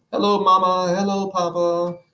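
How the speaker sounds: a lightly voiced sing-song mock of radio men; teasing wife parodying the broadcast; mocking explorers. Wife mocks radio greetings.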